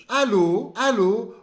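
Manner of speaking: loud "Allô", rising onto the last syllable; stress on final syllable. Shown as a demonstration. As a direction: drill "Allô" with a strong ending. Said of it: "a sílaba tônica fica no final".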